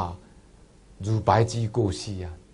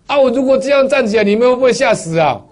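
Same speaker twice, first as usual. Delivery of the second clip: high, loud and laughing; mother's joking question; 11 semitones higher, 8 dB louder than usual. Retold or imitated. Revived old mother teases her family, high and laughing. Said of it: "他说".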